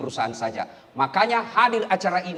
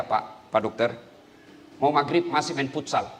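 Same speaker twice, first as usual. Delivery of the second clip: ordinary talk, nothing marked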